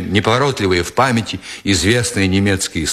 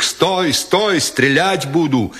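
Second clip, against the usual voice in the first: higher, drawn-out warning cry; piercing sentry shout; 6 semitones higher, slower than usual. A narrator's reading. Sentry shouting at a fleeing intruder, piercing halt. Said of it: "пронзительный окрик".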